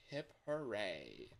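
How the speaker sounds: ordinary talk, nothing marked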